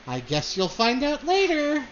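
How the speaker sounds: coy, high lilt climbing through the line; smiling tease. Acted. Daughter teasing her mother about an announcement, smiling and coy. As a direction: keep the secret with a smile in the voice. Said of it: "she kind of smiles and says".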